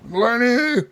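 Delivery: high, drawn-out mock holler; drunk yelling. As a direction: imitate a drunk calling out for a friend. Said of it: "drunk out of your fucking mind".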